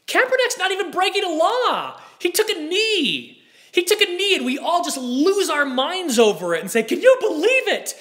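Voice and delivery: high-pitched, incredulous rant; exasperated disbelief. Real, not acted. Host ranting at the overreaction to a knee, exasperated disbelief.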